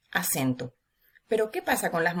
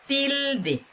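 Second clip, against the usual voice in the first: "tilde" with a long, high TIL; "tilde", first syllable held high, then dropping; stress on first syllable; 7 semitones higher than usual. Shown as a demonstration. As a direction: lean hard on the TIL of "tilde", drawn out. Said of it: "se pronuncia con mayor intensidad".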